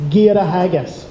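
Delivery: "Gíreathachas" demonstrated, a touch low; say the word. Shown as a demonstration. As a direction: sound out "Gíreathachas" for the crowd to copy. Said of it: "to say".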